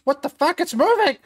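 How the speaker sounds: high, loud, panicked shriek of alarm; startled yelling. Acted. Game master voicing a startled bystander, high panicked yells. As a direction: yelp in shock as the thing starts moving. NPC startled by moving corpse.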